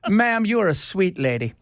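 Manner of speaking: a put-on Irish brogue, sweetly misunderstanding; Irish accent, naively polite; mock Irish accent. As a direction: answer an insult in a cheery Irish accent. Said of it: "called a C word in this accent".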